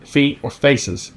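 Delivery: slow, deliberate telling-off; measured, emphatic retold warning; stern warning. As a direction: lay down the rule word by word. Shop owner's stern rule, retold.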